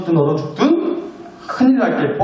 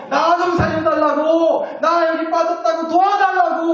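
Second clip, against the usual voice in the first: higher yelled pleas for help; yelling for help; 10 semitones higher than usual. Pretend. Someone in a pit yelling at passersby for help. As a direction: bellow for rescue from the pit. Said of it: "고래고래 소리 질러가면서".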